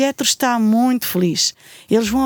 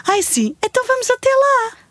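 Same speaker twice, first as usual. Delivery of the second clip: eager; 11 semitones higher than usual. Acted. Eager to visit.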